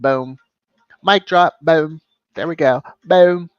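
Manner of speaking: loud, punchy gloating boom, mic drop; loud mock mic drop; triumphant boom. Real, not acted. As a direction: slam it home like you just won the argument.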